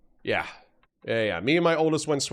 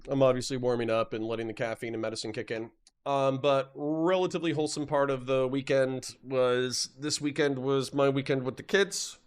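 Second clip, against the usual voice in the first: normal talking voice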